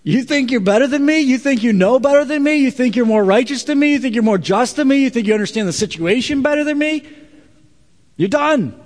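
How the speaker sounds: high, loud furious rebuke; angry God. Acted. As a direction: hammer each question, then cut him off. Imagined angry God rebuking Abraham.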